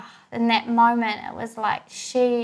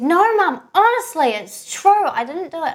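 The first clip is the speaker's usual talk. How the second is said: daughter's higher, louder insistent denial; acted-out child protesting innocence, pitched up; imitated earnest protest; 7 semitones higher, 6 dB louder than usual. Daughter swearing to mum she didn't do it, insistent.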